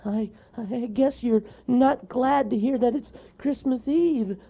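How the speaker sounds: frightened mole stammer. Acted. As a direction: stumble out the words, scared. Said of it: "he stammered".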